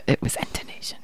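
hushed deathbed whisper. Pretend. To his co-host as mock last words, a whisper. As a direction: breathe it out like dying words.

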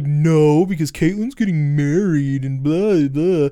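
lightly voiced whining complaint, loud and dragged out; mock venting. Dante complains to Randall about Caitlin getting married, mock venting.

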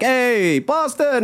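a cheery shout of Hey Boston; calling out. Retold or imitated. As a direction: call out to a fellow Boston fan. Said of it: "I'd be like".

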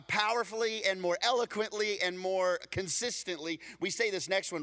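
ordinary delivery

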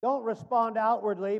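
ordinary talk

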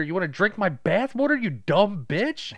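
loud, high-pitched ranting daughter; hammed-up yelling, last words dragged out; mock angry tirade. Daughter in the tub berating mom, mock fury.

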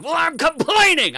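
high, loud outraged yell; angry yell. Retold or imitated. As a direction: yell it back at the landlord. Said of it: "I yelled at him".

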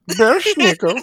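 put-on half-hearted German accent on Belschnickel. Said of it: "I have to do the accent".

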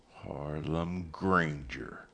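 soft, slow naming of a name; hushed, measured statement; said quietly. A narrator's reading. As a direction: let the name out slowly and quietly. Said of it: "said quietly".

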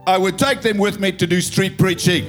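ordinary talk

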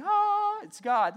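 high, loud gasp of wonder; mock awestruck gasp. Preacher mocking people who expect fanfare, awestruck gasp. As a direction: gasp as if the ceiling just opened. Mock awe at dramatic miracle.

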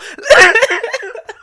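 real laugh breaking through; loud high cackle; high burst of laughter. Real, not acted. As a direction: laugh at your own joke, high and loud. Laughs at own bit.

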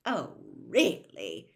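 slow exasperated sneer. A narrator's reading. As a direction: scoff wearily. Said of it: "in exasperation".

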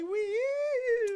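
high mock fan squeal; excited fan woo. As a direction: imitate a thrilled woman fan with a high woo. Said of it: "there's this woman who's just like".

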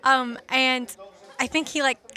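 ordinary talk, nothing marked

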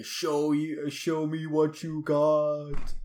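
slow booming voice from the sky; giant head impression. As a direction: boom it out like a giant floating head. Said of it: "the head from Rick and Morty".

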